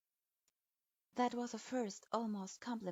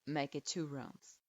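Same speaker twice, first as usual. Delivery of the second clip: put-on low, curt old teacher grading a student; a grudging low verdict; stern sensei voice; 7 semitones lower than usual. A narrator's reading. Strict sensei grudgingly approving his student, low and curt.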